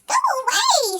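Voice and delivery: a high, sharp giant's wife; lightly voiced wife turning Jack away. A narrator's reading. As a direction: shoo the boy away from the door.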